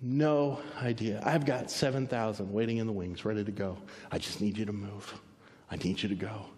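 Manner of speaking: low, soft, unhurried reassurance; hushed voice of God; God speaking gently. Acted. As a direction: settle into a quiet, low command. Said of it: "And God said".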